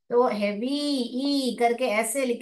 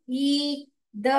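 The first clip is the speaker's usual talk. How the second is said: usual speaking voice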